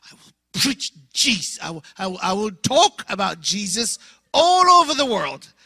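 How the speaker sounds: loud, ringing proclamation; prophetic vow. Retold or imitated. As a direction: declare it like a young preacher. His prophetic declaration to classmates.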